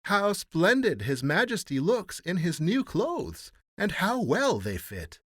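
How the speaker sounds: high, loud, drawn-out praise; crying out admiringly. A narrator's reading. Everyone admiring His Majesty's new clothes, crying out. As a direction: gush slowly and emphatically. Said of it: "everyone cried out".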